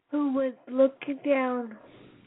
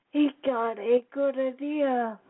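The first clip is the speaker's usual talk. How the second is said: usual speaking voice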